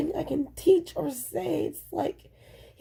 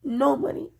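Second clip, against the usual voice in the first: ordinary talk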